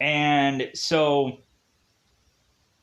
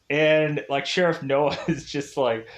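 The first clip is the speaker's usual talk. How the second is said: ordinary delivery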